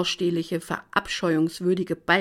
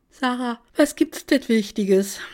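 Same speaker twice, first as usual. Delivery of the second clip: slow words stretched through a yawn; sleepy friend yawning into the camera; 5 semitones higher, 3 dB louder, slower than usual. Acted. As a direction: say it mid-yawn, just woken up. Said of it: "gähnt sie in die Kamera".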